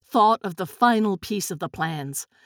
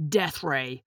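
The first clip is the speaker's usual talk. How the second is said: low, soft, cold menace; cold confirmation; 7 semitones lower, 5 dB quieter than usual. A narrator's reading. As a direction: confirm it coldly, dropping low and quiet. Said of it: "Buck answered coldly".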